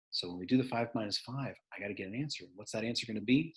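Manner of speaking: soft, hushed questioning; quiet voice. Shown as a demonstration. Teacher demoing the quiet setup, soft. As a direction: hush them so they lean in. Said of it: "And then I go like this".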